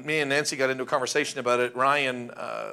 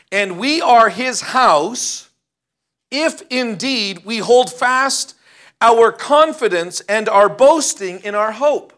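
slow, loud, weighty reading of the verse; solemn scripture reading; 6 semitones higher, 7 dB louder, slower than usual. Acted. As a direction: preach each phrase slowly with force. Reads scripture aloud.

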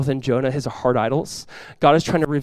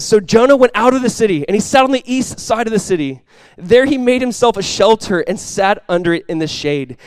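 reading scripture aloud, a little higher and louder; 4 semitones higher, 3 dB louder than usual. Acted. Preacher reads Jonah chapter four to the congregation, scripture reading. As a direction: read the passage out to the church.

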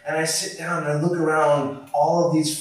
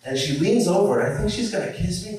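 ordinary delivery

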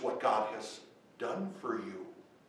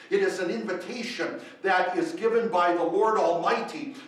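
full-force preaching; 5 semitones higher, 8 dB louder than usual. Acted. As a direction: drive each question home to the congregation. Preacher proclaims invitation.

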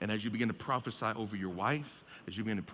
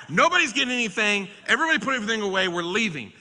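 much louder and much higher, racing and laughing through it; hammed-up dad losing it, laughing; mock yell at kids; 10 semitones higher, 11 dB louder, faster than usual. Father ordering his kids out of the gift shop, laughing mock yell.

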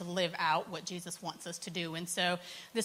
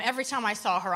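ordinary delivery

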